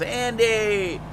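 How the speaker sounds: high, drawn-out greeting call; mock group yell. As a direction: call the name out like a bar crowd greeting a regular. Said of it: "everybody yells".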